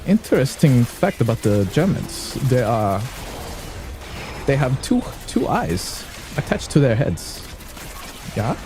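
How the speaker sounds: halting, swooping mock German accent. Shown as a demonstration. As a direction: put on a stilted German accent, word by word.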